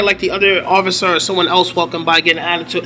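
ordinary talk, nothing marked

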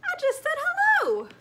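very high, loud cartoon voice; bubbly high Dory. Dory explains her whale.

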